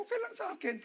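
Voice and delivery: a high mock quote of his own reply. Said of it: "Eh, sabi ko".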